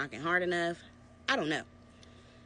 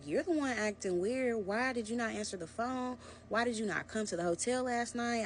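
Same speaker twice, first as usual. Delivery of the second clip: retold yelling; 6 semitones higher than usual. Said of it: "I start screaming at him".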